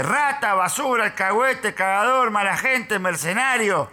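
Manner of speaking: high-pitched yelled string of insults; retold shouting. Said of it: "me grita".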